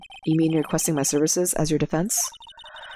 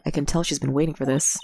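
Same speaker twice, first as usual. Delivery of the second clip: ordinary talk, nothing marked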